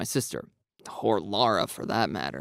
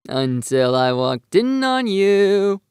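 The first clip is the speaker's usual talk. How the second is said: slow, high, louder teasing jab; drawn-out playful tease; 8 semitones higher, 8 dB louder, much slower than usual. A narrator's reading. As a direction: needle him playfully. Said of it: "I teasingly interject".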